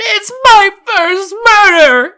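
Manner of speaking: loud, slow, high bawl. Acted. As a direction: wail it out in tears. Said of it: "bawled out".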